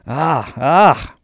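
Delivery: loud gruff exclamation; fierce exclaim. A narrator's reading. Captain ending the whale tale, fierce outcry. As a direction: burst out with a savage cry. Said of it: "alarmed by his energy".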